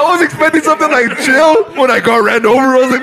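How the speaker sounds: barely holding it together; laughing. Real, not acted. Teasing his friend about getting run over, laughing. Still cracking up at story.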